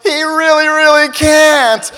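high, loud, slow plea; panicky dad. Retold or imitated. Dad pleading with the instructor, high and loud. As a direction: plead like a nervous father. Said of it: "I'm over here going".

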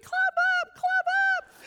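high, urging call to a kitten; coaxing a kitten. Retold or imitated. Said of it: "I was encouraging it".

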